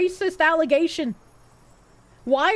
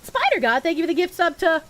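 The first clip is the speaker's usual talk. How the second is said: normal talking voice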